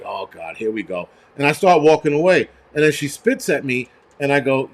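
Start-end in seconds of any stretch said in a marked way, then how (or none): 0.0-1.1 much softer, lower and fed up; weary groan. Retold or imitated. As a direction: sigh it out like you've seen this before. Weary reaction to her outburst.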